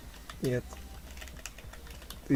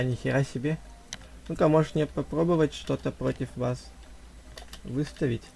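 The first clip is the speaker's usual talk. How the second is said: ordinary delivery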